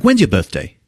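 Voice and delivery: a touch of British detective; hint of Oxford English; lightly done British accent. Voices Durkin, Oxford educated detective.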